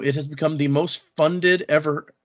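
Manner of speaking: ordinary talk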